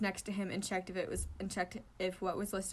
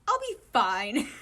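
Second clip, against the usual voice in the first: forced calm; 3 semitones higher, 10 dB louder, much slower than usual. Acted. Oikawa forcing calm.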